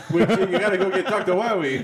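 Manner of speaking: laughing. Real, not acted. Teasing pronunciation of Dr. Wily.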